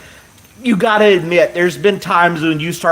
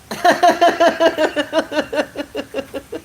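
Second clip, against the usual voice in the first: long high helpless giggle; hard laughing; 12 semitones higher than usual. Real, not acted. Streamer overcome by a viewer's story, long helpless laugh. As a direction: let a real high laugh run on and on. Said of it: "I haven't laughed this hard".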